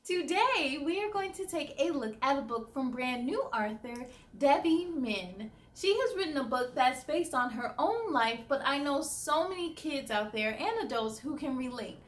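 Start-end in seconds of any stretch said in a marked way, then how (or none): none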